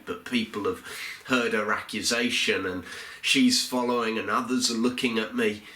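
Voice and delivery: ordinary delivery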